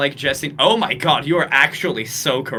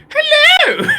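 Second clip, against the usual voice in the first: very high, loud squealed greeting; hammed-up piercing high character voice; Yashin; 11 dB louder than usual. Shown as a demonstration. Podcaster trying out a voice for a character, shrieky high greeting. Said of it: "which I will just do, like, the".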